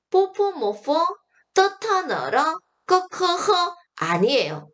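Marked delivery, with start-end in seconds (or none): 0.1-3.5 higher-pitched, syllables run together in a rush; hurried reading. Shown as a demonstration. Teacher mimics students rushing the drill, hurried and higher. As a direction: rattle the drill off too hastily. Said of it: "쌤이 급하게 읽지 마라 그랬어요".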